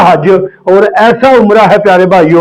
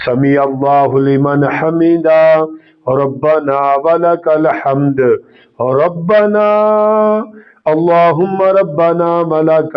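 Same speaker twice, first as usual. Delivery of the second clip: prayer recitation demo; 5 dB quieter, slower than usual. Shown as a demonstration. Preacher showing the congregation how to pray, slow soft recitation. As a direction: recite the prayer slowly as a practical demonstration. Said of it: "practical طریقہ بالکل آسان".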